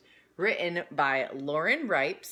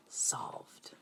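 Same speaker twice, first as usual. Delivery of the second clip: whispered ending; 11 dB quieter than usual. A narrator's reading. Last word whispered.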